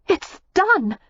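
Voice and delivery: put-on young woman pleased with herself after freeing the goose; triumphant. A narrator's reading. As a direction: proclaim it with a little triumph. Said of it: "she said triumphantly".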